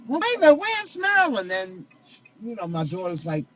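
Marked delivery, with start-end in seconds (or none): 0.1-1.5 high, loud frantic question; retold alarm. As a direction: re-enact searching urgently for someone missing. Retells frantic search for Marilyn.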